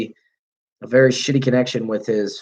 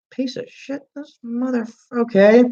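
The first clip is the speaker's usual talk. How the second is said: high outraged disbelief at the insult; indignant surprise; 11 semitones higher than usual. Retold or imitated. Owner to cop, defending his truck, high offended squeak.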